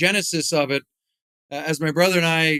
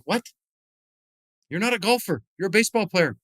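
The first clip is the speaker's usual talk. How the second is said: mock disbelief, pitched slightly up; imitated baffled kids; 4 semitones higher, faster than usual. Kids doubting the athlete at golf, mock-baffled. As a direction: puzzle it out like a baffled kid.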